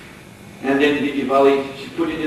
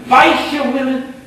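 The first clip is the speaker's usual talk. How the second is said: high, loud, scandalized echo of the word; indignant disbelief; 12 semitones higher, 6 dB louder than usual. Acted. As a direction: fling it back in shocked contempt. Brahmin girl scoffs at cowherd women.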